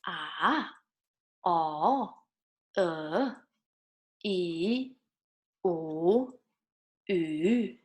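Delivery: "Ah, o, e, u, ü" each dipping, then rising; third tone. Shown as a demonstration. Teacher drills third tone, each vowel down and up. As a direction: scoop each vowel down and back up. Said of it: "third tone goes down and up".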